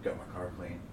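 low, soft deadpan repeat; flat droning friend; monotone voice. Retold or imitated. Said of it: "he's got that monotone voice".

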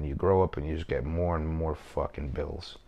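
ordinary delivery

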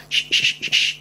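voiceless "sh", repeated separately; isolated "sh" consonant, no voice; SH sound demo. Shown as a demonstration. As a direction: push out short hisses of "sh". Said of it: "la S H".

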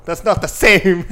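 loud laugh through the words. Real, not acted. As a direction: crack up while saying it. Laughing at the comparison.

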